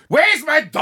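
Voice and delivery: high, hammed-up yell; mock demanding shout. Randall back on the scare floor, mock demanding yell.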